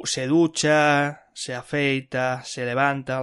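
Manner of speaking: "se ducha" said slowly and firmly; teacher slowing right down on "se ducha"; slow example. Shown as a demonstration. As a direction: draw out "se ducha" for the learner. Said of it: "se gu".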